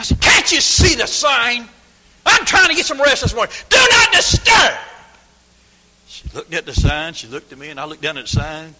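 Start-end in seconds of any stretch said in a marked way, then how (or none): 0.2-4.8 furious high yell, re-enacted; angry shouting. Woken motel guest yelling at the maid, angry shouting. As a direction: rip into her at full volume. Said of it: "I ripped into that dear woman".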